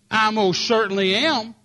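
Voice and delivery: mock offended lady official, slow and loud; indignant official. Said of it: "lady official insisting".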